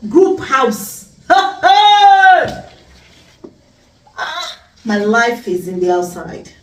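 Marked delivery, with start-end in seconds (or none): 1.3-2.6 high, long, loud burst of laughter; loud mocking laugh. Real, not acted. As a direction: crow with laughter at the reveal. Laughs after revealing group house.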